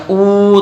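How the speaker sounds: ऊ held long, dragged out; wrongly stretched vowel. Shown as a demonstration. Teacher showing children the mistake, ऊ stretched. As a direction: draw ऊ out far too long. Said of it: "बगैर खींचे".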